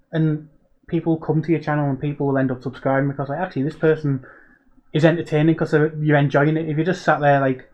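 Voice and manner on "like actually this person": ordinary delivery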